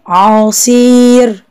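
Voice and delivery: عصير slow, the "ee" held long; long vowel in عصير. Shown as a demonstration. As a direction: stretch the ee of عصير for pupils. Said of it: "الحرف اللي ناقص هو حرف الصاد".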